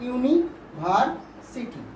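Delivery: "University" slowly as ইউনি, ভার, city; short gaps between the three parts of "university"; split into syllable groups. Shown as a demonstration. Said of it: "তিনটে করে syllable হচ্ছে".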